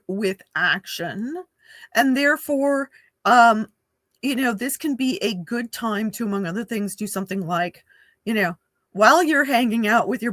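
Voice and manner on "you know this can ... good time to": ordinary delivery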